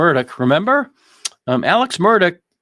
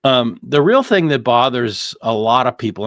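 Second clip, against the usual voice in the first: usual speaking voice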